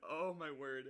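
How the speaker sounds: usual speaking voice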